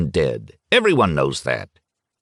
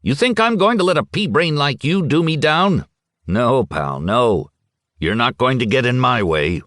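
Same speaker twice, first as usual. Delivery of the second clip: spitting insults, barely held in; mild mock rage; 6 semitones higher than usual. Schmidt grabs Futaki's coat and berates him, a touch angry. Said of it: "Schmidt lost his head entirely".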